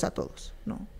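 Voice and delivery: a todos dropped to a breathy whisper; a todos, whispered as an afterthought. Real, not acted. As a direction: let a todos fall to a whisper. Repeats "a todos" louder then whispered.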